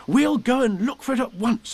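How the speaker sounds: high, urgent call to a friend. Acted. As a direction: call it out eagerly, pitched well up. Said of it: "he called out to Eeyore".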